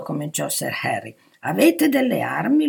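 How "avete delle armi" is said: louder, high-pitched insistent question; interrupting. A narrator's reading. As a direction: cut in and press for an answer. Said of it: "lo interruppe il duca". (domanda insistente che interrompe, a voce alta)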